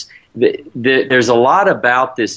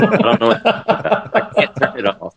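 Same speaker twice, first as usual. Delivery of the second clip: helpless laughter breaking into the words; laughing; 8 semitones higher than usual. Real, not acted. Guest explains his beeping computer to the hosts, laughing. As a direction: talk through a laugh, pitch jumping up.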